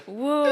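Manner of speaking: loud high bird squawk; bird call. Pretend. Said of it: "that was a badass bird sound".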